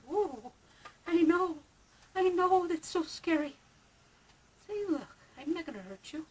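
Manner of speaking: high, gentle cooing. Real, not acted. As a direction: coo at a scared pet.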